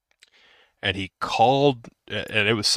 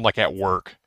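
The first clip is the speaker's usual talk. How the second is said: ordinary delivery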